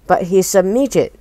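ordinary delivery

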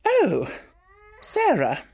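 high, startled "Oh, Sarah"; startled exclaim. A narrator's reading. Flustered headmistress caught out in the hall, exclaiming. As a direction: caught off guard, forcing brightness. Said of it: "she exclaimed".

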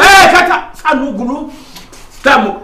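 high, loud exclamations; angry outburst. Acted. Father cutting off his protesting son, angry high outburst. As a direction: burst out to shut down protest.

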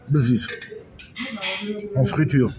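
ordinary talk, nothing marked

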